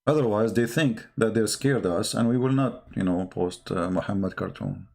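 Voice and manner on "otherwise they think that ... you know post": usual speaking voice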